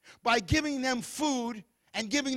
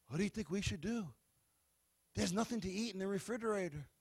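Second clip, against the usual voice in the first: softer, anxious mumble; a worried brother; 6 dB quieter than usual. Acted. Brother to brothers, lost and worried, softer. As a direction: ask helplessly.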